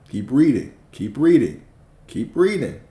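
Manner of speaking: insistent call building up; building urging. Real, not acted. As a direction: push harder with every repeat. Urging listeners to keep reading.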